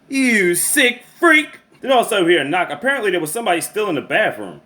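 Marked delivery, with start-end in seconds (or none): 0.1-1.5 high, drawn-out disgusted jeer; hammed-up disgusted jab, much higher and slow; mock disgust. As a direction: sneer it out slowly like he's revolting. Disgusted reaction to the pervert.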